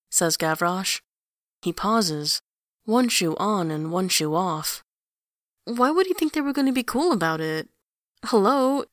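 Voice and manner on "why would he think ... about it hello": fast, high disbelief; incredulous. A narrator's reading. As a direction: rattle it off, can't believe it. Kid incredulous at brother.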